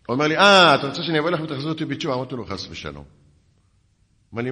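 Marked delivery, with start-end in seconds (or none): 0.4-2.1 loud, fast, higher-pitched mock challenge; acted-out indignant disbelief; retold incredulous retort. Acts out the indignant caller. 2.5-3.0 low, soft dismissal; understated acted-out rebuff; retold cool refusal. Acts his own cool reply.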